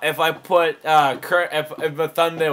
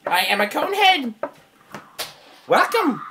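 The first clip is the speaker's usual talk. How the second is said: high-pitched alien monotone; Conehead; 7 semitones higher than usual. Acted. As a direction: announce yourself in a high, stiff alien voice. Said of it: "I'm gonna do my Conehead impression".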